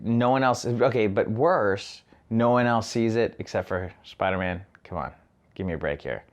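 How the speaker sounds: ordinary talk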